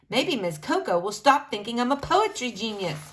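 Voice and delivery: ordinary delivery, nothing marked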